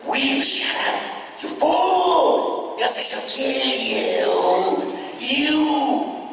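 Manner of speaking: high, strained, slow menacing chihuahua threat; Ren voice. Acted. Voice actor demonstrating Ren to fans, unhinged and shrill. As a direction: shriek it like a deranged little dog. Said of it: "that's what worked it into the Ren character".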